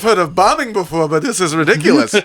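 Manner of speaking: high Borscht Belt comic voice; Mel Brooks voice. Pretend. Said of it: "sounds like Mel Brooks".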